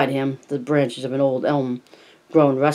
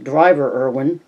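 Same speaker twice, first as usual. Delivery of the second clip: plain reading voice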